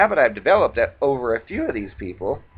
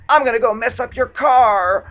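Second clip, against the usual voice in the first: high, loud threatening yell; shouted threat; 9 semitones higher, 5 dB louder than usual. Retold or imitated. The raging man outside threatening her car, shouting. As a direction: bellow the threat through the door. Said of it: "I heard a threat".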